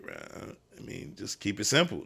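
soft, low, breathy-edged aside to the other coach; exasperated aside. Retold or imitated. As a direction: quietly plead for a simpler play. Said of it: "we were just sitting there like".

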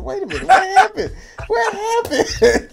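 high, laughing disbelief, cracking up; laughing mock innocence. Retelling his confused reply to friends, laughing high. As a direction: play innocent while laughing at them. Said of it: "I said".

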